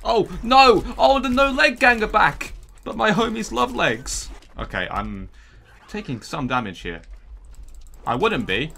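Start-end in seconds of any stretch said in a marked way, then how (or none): 0.0-4.3 loud high protest; frantic yelling. Real, not acted. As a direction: shout in exasperation. Enemies regenerate, frantic.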